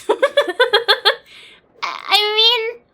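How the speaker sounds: giggling high through the words; laughing. Real, not acted. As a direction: crack up mid-sentence. Laughing at ugly character.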